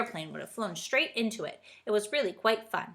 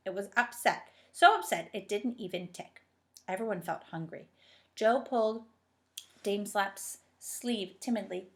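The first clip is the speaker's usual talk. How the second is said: ordinary delivery, nothing marked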